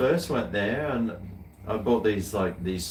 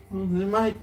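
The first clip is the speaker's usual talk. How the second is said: cocky Aussie bloke; 8 semitones higher than usual. Retold or imitated. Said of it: "I'm like".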